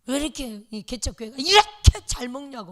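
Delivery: loud, indignant complaint; old deaconess loudly griping about the feasting; retold angry scolding. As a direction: demand to know why they eat so well. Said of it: "막 역정을".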